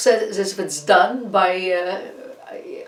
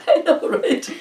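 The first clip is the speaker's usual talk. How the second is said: laughing; 10 semitones higher, 3 dB louder than usual. Real, not acted. Laughing at own messy hair.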